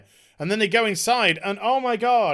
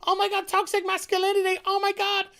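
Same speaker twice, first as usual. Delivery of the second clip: much higher, shrieking mock outrage; mock outraged tweeter; 11 semitones higher, 4 dB quieter than usual. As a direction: parody a scandalized online mob. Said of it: "there would be outrage on Twitpat".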